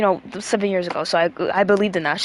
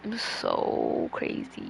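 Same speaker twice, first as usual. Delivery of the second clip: slow whisper; 10 dB quieter, much slower than usual. Real, not acted. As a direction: whisper it slowly to herself. Whispered aside to herself.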